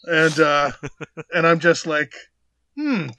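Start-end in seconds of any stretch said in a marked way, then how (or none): 2.8-3.1 high, awkward hums and false niceness; hammed-up polite discomfort, pitched high; mock foot-in-mouth embarrassment. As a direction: squirm through it in a high put-on voice.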